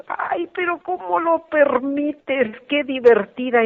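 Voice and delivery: high, slow incredulous cry; shocked protest. Retold or imitated. Daughters protesting to dad about his witch friend, high and shocked. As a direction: act out disbelief at father.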